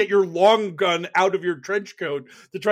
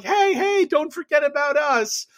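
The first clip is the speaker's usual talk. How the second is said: little Georgia calling out; 9 semitones higher than usual. Retold or imitated. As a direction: wave your arms, calling for attention. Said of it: "poor little Georgia is like".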